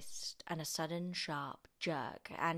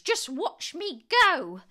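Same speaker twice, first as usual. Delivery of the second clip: high, loud, drawn-out screech; triumphant calling out; 12 semitones higher, 12 dB louder, slower than usual. A narrator's reading. Grandma calls down to George, boastful screechy holler. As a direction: crow it slowly at full voice. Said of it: "she called down to George".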